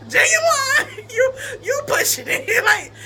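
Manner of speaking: high laughing shout; half shouting through the giggles; laugh-crying the words out. Real, not acted. Laughing at ex's looks.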